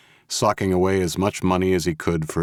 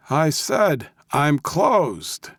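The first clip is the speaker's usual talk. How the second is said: put-on high-pitched crabby old codger; crabby old man yell; 11 semitones higher, much slower than usual. A narrator's reading. Old man shooing off visitors in a limo, crabby yell. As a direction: call it out like a cranky old man. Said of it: "he yelled in his best crabby old man voice".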